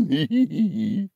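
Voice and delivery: high quavering hee-hee; nervous bleating giggle. Acted. Middle goat facing the troll, high nervous bleat. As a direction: trail out a high goat titter.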